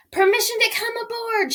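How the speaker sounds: high, loud call up to the ship; shouted. Acted. Pig asking to board the pirate ship, high excited shout. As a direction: shout it up to the deck from below. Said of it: "shouted Rufus".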